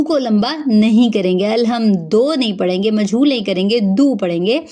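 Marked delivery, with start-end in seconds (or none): none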